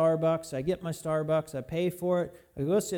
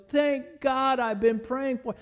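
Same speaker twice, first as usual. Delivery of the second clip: overjoyed relief; 6 semitones higher, slower than usual. Retold or imitated. Owner gets her lost ring back.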